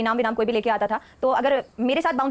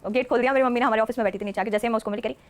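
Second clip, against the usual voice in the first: ordinary talk, nothing marked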